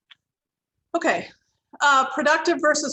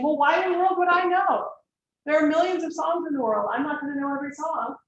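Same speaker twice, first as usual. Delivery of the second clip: higher, louder exasperated snap; irritated retort; 4 semitones higher, 3 dB louder than usual. Shown as a demonstration. Counselor showing how not to answer a client, irritated high snap. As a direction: show the wrong, irritated way to answer. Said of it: "rather than getting irritated and saying".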